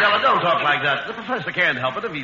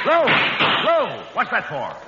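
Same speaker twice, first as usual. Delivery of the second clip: calling out; slower than usual. Acted. Abbott calling out to Lou.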